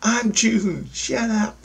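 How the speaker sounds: very high little put-on voice; voice of innocence. Innocence interrupting the dreamer's thoughts, high put-on voice. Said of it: "I hear my innocence go".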